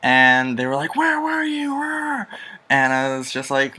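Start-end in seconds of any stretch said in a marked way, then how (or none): 1.0-2.3 very high, strained imitation of yelling parents; mock parental screaming. Parents confront their child coming home late, high mock yelling. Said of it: "they're screaming and yelling".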